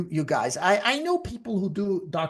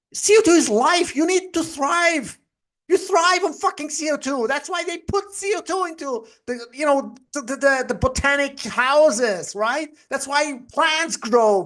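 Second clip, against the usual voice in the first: heated ranting; 8 semitones higher than usual. Real, not acted. Heated rant about CO2.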